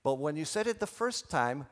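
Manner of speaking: ordinary talk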